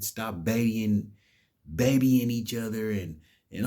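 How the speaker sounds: ordinary delivery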